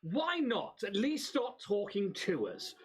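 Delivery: tantrum. Acted. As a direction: throw a haughty noble's tantrum.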